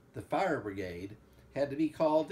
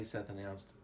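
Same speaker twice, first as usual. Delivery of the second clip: usual speaking voice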